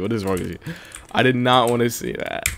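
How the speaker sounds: normal talking voice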